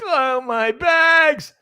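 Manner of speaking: high, very slow mock lament; hammed-up crypto whiner; mock whining. Mocking a holder crying over his bags.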